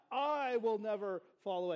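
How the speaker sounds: "I" stretched and hit hard, exaggerated; stress on I. Shown as a demonstration. Preacher demonstrates Peter's grammar to the congregation, exaggerated stress on "I". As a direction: overdo the emphasis on the pronoun "I". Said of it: "Emphasis on the pronoun I".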